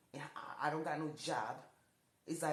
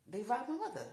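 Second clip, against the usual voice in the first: louder and higher, pressing the point; emphatic repeat; 6 semitones higher, 5 dB louder than usual. Real, not acted. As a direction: hit it again with force. Repeats, louder, mother robbed.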